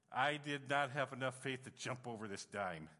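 usual speaking voice

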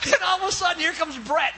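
ordinary delivery, nothing marked

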